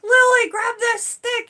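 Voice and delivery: high, loud, slow urgent shout; urgent call. Retold or imitated. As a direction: call out to a child stuck in mud. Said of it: "and I'm like".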